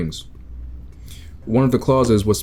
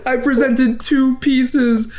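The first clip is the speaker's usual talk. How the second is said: laughing; 14 semitones higher than usual. Real, not acted. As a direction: gloat through laughter. Gloating while laughing.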